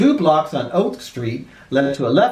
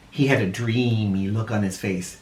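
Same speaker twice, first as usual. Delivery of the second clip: ordinary delivery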